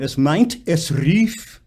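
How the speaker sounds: "meint" and "rief" set apart, endings spat out; unhurried final consonants. Shown as a demonstration. Coach asks singer to take time, "meint" and "rief" with spat endings. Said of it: "Take a little time".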